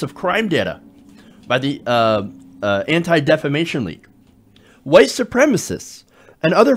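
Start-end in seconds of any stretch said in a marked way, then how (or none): none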